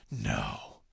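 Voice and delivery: low, hushed refusal; whispered. A narrator's reading. Looking down from the truck window, whispered refusal. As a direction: drop to a very soft, deep whisper. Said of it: "and whispered".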